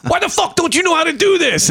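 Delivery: a hammed-up furious dad; dad yelling. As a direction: blast it like an angry father. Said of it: "It's my dad going".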